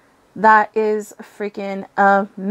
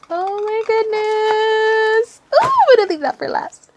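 very high-pitched squeal of delight; overjoyed squeal; 11 semitones higher than usual. Real, not acted. Overjoyed at next item.

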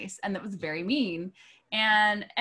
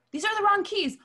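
much higher, louder indignant; mock outraged diva; 11 semitones higher, 5 dB louder than usual. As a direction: throw the complaint like a tantrum. Artist complains at show.

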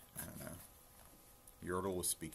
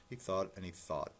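plain reading voice

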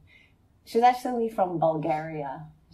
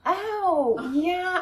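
reluctant friend; 11 semitones higher, 4 dB louder than usual. Retold or imitated. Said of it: "she'll be like".

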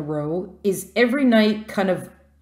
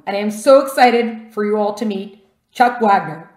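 ordinary delivery

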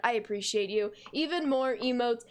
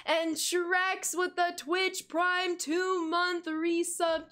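hype announcer; 5 semitones higher, much slower than usual. Acted. Hyping a sub announcement.

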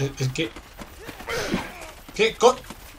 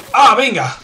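loud, high cry of protest; exasperated outburst; 6 semitones higher, 7 dB louder, slower than usual. Real, not acted. Gamer protesting at the game, exasperated outburst.

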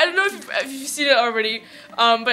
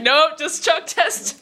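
slow, drawn-out meme line; meme quote; 5 semitones higher, 7 dB louder, much slower than usual. Retold or imitated. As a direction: deliver it slowly like the meme. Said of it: "I'm just like".

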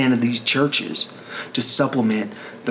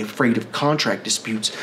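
ordinary delivery

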